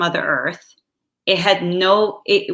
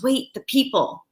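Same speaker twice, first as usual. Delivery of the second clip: higher-pitched retold alarm; alarmed warning; 7 semitones higher than usual. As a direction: re-enact a driver's sudden warning. Retelling cab driver's alarmed warning.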